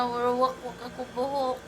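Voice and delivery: ordinary talk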